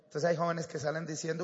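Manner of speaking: ordinary delivery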